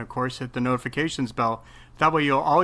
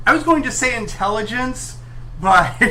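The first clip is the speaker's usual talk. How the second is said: cracking up mid-sentence; laughing; 11 semitones higher, 8 dB louder than usual. Real, not acted. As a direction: voice jumping up with laughter.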